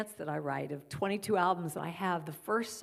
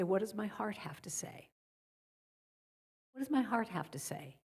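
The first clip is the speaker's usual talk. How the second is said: a gentle, hushed check-in with yourself; quietly asking your own heart; soft inner question; 5 dB quieter than usual. Acted. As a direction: ask it softly, hand on heart. Voicing her morning heart question.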